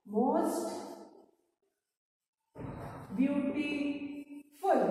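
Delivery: drawn-out, spaced-out dictation pace; slow dictation. Shown as a demonstration. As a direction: stretch each syllable, leaving long gaps. Dictating the answer word by word.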